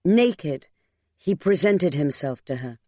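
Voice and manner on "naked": ordinary delivery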